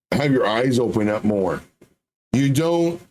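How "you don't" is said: ordinary delivery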